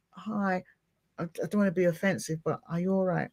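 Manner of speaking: soft, careful approach to a stranger; gentle concern. Retold or imitated. Asking a crying stranger on a train if she is all right, softly and gently. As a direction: ease into it softly, not to offend.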